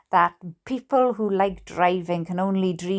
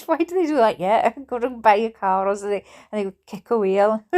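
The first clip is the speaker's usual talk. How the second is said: plain reading voice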